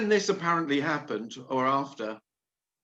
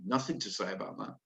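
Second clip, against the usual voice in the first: quietly dismissive; 7 semitones lower, 12 dB quieter, faster than usual. Retold or imitated. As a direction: drop much softer, fast and offhand. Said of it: "You asked me that and I went".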